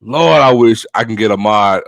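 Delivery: ordinary talk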